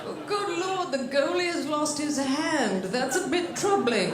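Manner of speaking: slow, drawn-out mock commentary; imitated sports announcer. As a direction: report disaster with an announcer's measured understatement. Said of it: "That's really how they call the shit".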